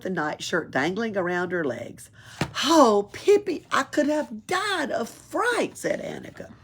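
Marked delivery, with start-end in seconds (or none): 2.6-5.8 high, slow, shaken relief; relieved child. Acted. Annika to Pippi after the fright, high relieved voice. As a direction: sigh it out after a scare.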